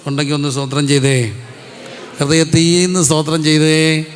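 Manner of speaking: rising preacher's exhortation; call to praise. Acted. Preacher calling the congregation to praise from the heart. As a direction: urge the crowd to give thanks.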